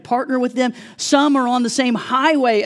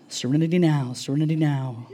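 mock calm mantra; 6 semitones lower, 4 dB quieter than usual. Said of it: "massaging his own temples".

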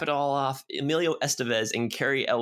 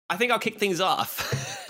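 playful shout; 7 semitones higher than usual. Real, not acted. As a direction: call it out with gusto. Playfully announces starting segment.

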